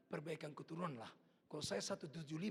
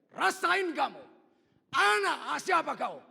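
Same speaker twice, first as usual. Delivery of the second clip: high, very loud yell; drawn-out taunting holler; mock scolding shout; 12 semitones higher, 17 dB louder, much slower than usual. Father mockingly yells at his son in the ditch, high shout. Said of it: "Apa saya teriak".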